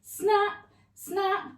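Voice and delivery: each snap popped high; high snap sounds. Shown as a demonstration. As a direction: snap it up high like jaws closing. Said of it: "we go snap".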